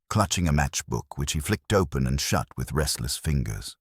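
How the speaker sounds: plain reading voice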